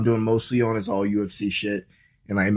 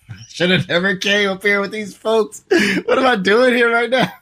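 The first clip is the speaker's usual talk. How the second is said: higher, louder, laughing through fake despair; laughing panic; 8 semitones higher, 3 dB louder than usual. Stranded traveler laughs at his own panic, higher and louder. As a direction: cry out in half-laughing regret.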